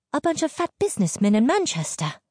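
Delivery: high, quick, contemptuous retort; protesting, pitch well up; indignant retort. A narrator's reading. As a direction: fling it back fast and high. Wife argues back at husband.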